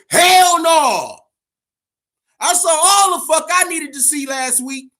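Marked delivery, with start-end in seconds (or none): 0.1-1.2 loud, emphatic refusal; emphatic shout. Real, not acted. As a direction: shout it down hard. Answering fans' trilogy question.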